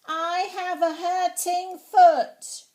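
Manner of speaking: very loud, slow, high yell; shouting. Acted. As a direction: call from far behind, slowly. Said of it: "so I had to shout".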